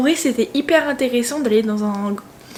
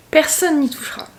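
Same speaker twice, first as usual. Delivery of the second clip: ordinary talk, nothing marked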